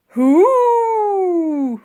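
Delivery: high, loud trumpeting roar; mammoth roar. A narrator's reading. Woolly roaring at the police, loud roar. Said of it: "roared the mammoth".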